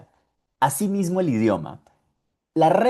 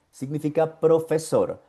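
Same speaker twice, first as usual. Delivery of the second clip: ordinary talk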